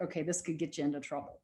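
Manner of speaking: soft, quick murmur fading to a whisper; quietly calming herself on the bike; calm self-talk. Retold or imitated. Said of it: "to be like".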